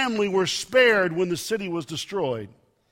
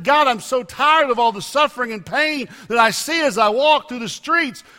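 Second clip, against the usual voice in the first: crying out to God; 4 semitones higher, 6 dB louder than usual. Retold or imitated. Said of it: "he's crying out to God".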